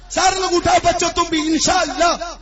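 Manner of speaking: loud, high crowd rouser; preacher calling the whole crowd to answer; rousing call. Real, not acted. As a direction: whip the audience into saying it. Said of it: "ہاتھوں کو فضا میں لہرا کر بولیے".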